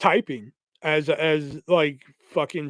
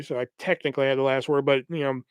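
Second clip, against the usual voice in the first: normal talking voice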